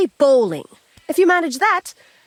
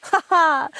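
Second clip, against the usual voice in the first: short gloating laugh; mocking laugh; 5 semitones higher than usual. Real, not acted. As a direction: laugh in his face. Lady laughs at the bailiff.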